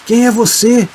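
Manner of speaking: shouted question. A narrator's reading. Fallen Fidêncio challenges the approaching figure, high shout. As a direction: call it out high, demanding an answer. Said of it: "gritou perguntando".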